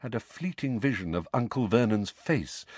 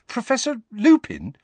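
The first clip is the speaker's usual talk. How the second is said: high, stammered question in disbelief; incredulous, raised voice; disbelieving; 13 semitones higher, 5 dB louder than usual. A narrator's reading. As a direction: stammer the name as if you can't believe it. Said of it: "he said disbelievingly".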